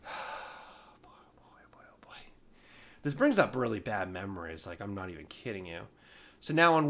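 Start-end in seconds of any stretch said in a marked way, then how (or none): 0.0-2.2 breathless whispered dread. Real, not acted. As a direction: whisper it like a bad memory. Dreading bad memories.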